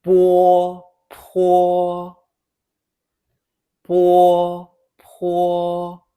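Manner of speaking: "Bo, po" held high and level, only the p puffed; contrasting pairs. Shown as a demonstration. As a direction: alternate plain b and aspirated p in "bi, pi, bu, pu". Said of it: "Now the other pairs".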